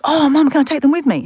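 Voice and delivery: high, loud, eager request; acted eager asking. Daughter asking to take steamed buns, acted eager plea. Retold pleading with mum.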